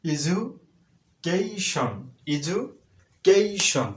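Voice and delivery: education drawn out slowly, ti said as sh; slow careful demo. Shown as a demonstration. Teacher models education slowly for students. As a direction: stretch out education syllable by syllable. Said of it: "উচ্চারণটা অবশ্যই স".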